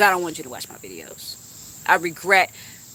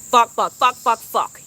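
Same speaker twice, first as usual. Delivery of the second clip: loud burst of swearing; cussing outburst; 3 semitones higher, 6 dB louder, slower than usual. Shown as a demonstration. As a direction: fire off the curse words. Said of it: "I just use some cuss words".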